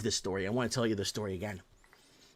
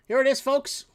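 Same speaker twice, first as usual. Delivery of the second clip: much higher, louder reveal; big higher, louder headline reveal; showman announce; 13 semitones higher, 7 dB louder than usual. Real, not acted. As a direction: present it like a game show host.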